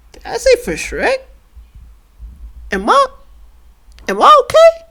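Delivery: high, squeaky disbelief at himself; baffled self-questioning. Real, not acted. Baffled by his own slip.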